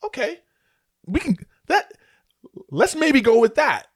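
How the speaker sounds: high, hesitant voice of a casting director, slowing at the end; impressed casting director. Retold or imitated. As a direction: play the casting director warming to him, slowly. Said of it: "it was a look of like".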